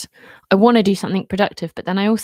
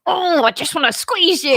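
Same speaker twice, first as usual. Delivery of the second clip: put-on higher, squealy voice of a child hugging a pet; imitating the kid; 9 semitones higher than usual. Mother imitating her son squeezing the dog, high and gushing. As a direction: squeal it like a kid cuddling a puppy. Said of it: "Grayson will pick her up and be like".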